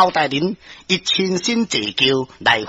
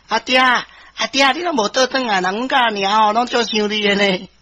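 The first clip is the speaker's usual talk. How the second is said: little son pleading; 4 semitones higher than usual. Acted. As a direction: call for daddy like a homesick child. Small son calls to his father. (囡仔哀哀叫阿爹)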